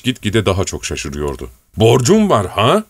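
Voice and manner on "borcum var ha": "Borcum var ha?" shouted, slow and rising, BORCUM hit hard; stunned old servant yells "Borcum var ha?" slowly; shocked shout. Acted. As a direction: cry out "Borcum" in disbelief, dragging the line. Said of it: "diye bağırdı".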